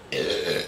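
short snorting laugh. Real, not acted. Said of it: "did I just snort?".

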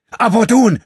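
full-throated, very high yelled name; shout. A narrator's reading. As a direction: scream his name to stop the blow. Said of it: "schrie André".

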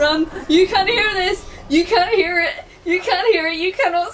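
loud, high panicked; panicked shouting. Real, not acted. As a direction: yell it in a panic.

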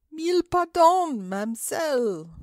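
a flustered Frenchman begging pardon; de Kock's apology. Acted. Monsieur de Kock apologizes to the old lady, high and gallant. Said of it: "replied Monsieur de Kock".

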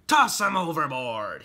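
loud pirate captain's yell; yelled order. Acted. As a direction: yell the command to the whole crew. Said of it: "he yelled to the other bunnies".

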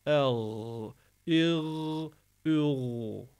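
"El, il, ül" with long gargled gh endings; rubbed throat. Shown as a demonstration. Teacher demonstrating ghayn, throaty "El, il, ül". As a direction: scrape the voice along the throat on each ending. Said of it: "boğazı sürterek olacaktı".

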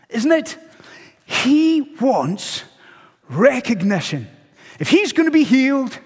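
high, emphatic sermon delivery; impassioned preaching. Real, not acted. Preacher exposing Naaman's pride to congregation, impassioned. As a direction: drive each point home with punched key words.

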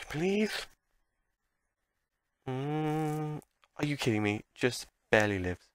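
usual speaking voice

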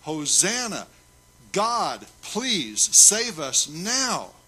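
loud, high, drawn-out desperate plea; children's cry, preached at full voice. Acted. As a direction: cry out to God, stretching every word. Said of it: "began to cry out".

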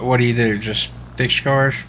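normal talking voice

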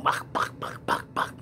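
breathy bok bok gunfire noises; gunshot imitation. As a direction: mimic rapid pistol shots with your mouth.

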